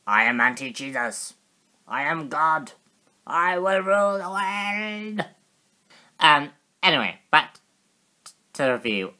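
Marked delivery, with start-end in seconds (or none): 0.1-5.3 booming villain, rising higher; evil overlord voice for a toy; megalomaniac rant. Pretend. Toy figure villain voice continues.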